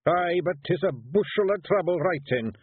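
high, Scottish Feegle warrior protesting; high Scots pixie voice wheedling his way out. A narrator's reading. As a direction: plead like a small nervous Scottish warrior. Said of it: "said Rob".

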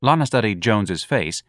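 ordinary delivery

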